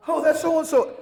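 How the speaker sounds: high, loud exclamation; acted excited recognition. Actor recognizing an old crew member, loudly excited. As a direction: call it out high and loud, as if spotting someone.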